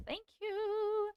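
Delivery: "Thank you" with "you" lifted high and drawn out; playful lilting "Thank you"; singsong thanks. Real, not acted.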